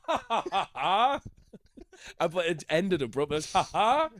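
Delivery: high put-on ha ha cut short; a fake laugh that stops dead. Comedian imitating the lone audience laugh, high and abrupt. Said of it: "It wasn't a real laugh".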